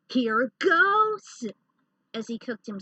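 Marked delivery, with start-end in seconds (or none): 0.1-1.4 high, loud battle cry, the word goes held long; narrated scream. A narrator's reading. Sion screams at the pinned ninja, a loud battle cry. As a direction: shout it as you kick. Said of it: "Then Sion screamed".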